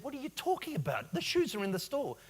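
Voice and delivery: baffled, calling out the nonsense; incredulous outburst. Retold or imitated. Retelling argument with phone shopper.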